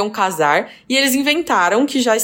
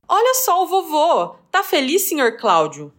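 cheerful aunt; 6 semitones higher than usual. Retold or imitated. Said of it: "a tia virou e soltou um".

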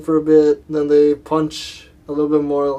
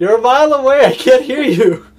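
high, loud long-distance yell; mock shouting; 11 semitones higher, 10 dB louder than usual. Hero yelling to a villain a mile away, shouting. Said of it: "Shazam on the other side's like".

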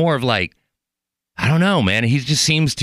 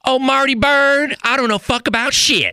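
Ruth from Ozark; 14 semitones higher than usual. Retold or imitated. A host doing a twangy Ozark impression for the guest. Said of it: "a Ruth from Ozark".

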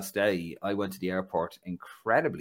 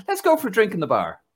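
high, louder inviting stranger; imitating Roberto; 12 semitones higher, 6 dB louder than usual. Imitating Roberto inviting him for a drink, much higher and louder. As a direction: lure him to the bar, pitched up. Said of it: "And he goes".

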